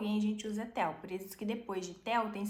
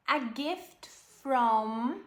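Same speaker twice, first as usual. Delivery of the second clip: slow model reading; 3 semitones higher, 4 dB louder, much slower than usual. Shown as a demonstration. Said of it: "slow model reading".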